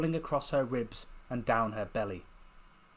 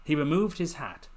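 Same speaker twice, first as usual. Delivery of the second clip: ordinary delivery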